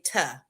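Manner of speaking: "tuh" for "to", low and clipped; "tuh" said short and low; lazy "tuh". Shown as a demonstration. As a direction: drop the vowel to "tuh". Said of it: "I say".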